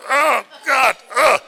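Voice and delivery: high, loud groan of effort; mock strained groan. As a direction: grunt like the socks won't budge. Struggling to pull socks on.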